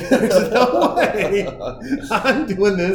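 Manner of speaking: slow words through laughter; laughing. Retold or imitated. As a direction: chuckle the words out. Continues laughing outrage.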